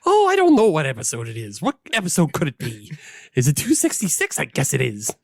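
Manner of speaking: lightly put-on Irish lilt, hesitant and tentative; mock Irish. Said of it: "Well, I'm doing you. You're Irish".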